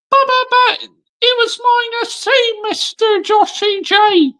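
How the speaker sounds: a shrill-pitched taunting fan; squeaky heckler. Acted. Rival fan taunting Joshy J, high squeaky mock voice. Imagined critic objecting to him.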